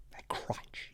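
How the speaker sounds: breathy hushed aside; unvoiced conspiratorial whisper. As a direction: whisper it like a secret.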